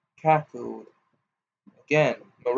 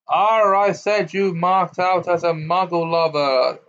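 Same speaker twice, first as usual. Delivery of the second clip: loud sneering growl; 5 semitones higher, 5 dB louder than usual. A narrator's reading. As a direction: sneer with contempt. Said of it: "sneered Gorn".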